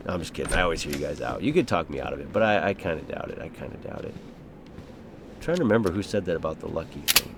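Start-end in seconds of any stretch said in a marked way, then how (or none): 3.4-4.1 breathy whispered aside. Shown as a demonstration. Streamer whispers his own line back to viewers. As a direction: whisper it under your breath like a secret.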